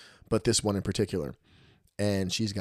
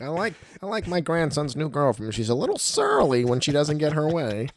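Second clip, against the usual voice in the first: higher, put-on old grandpa voice; old man grumbling; 7 semitones higher than usual. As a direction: play a fussy old man judging the girlfriend. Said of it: "Maybe when I'm sixty".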